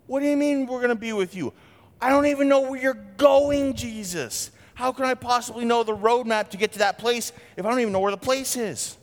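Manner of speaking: a disciple protesting he has no clue; confused Thomas. Retold or imitated. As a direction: play Thomas baffled and a bit exasperated. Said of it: "Thomas says".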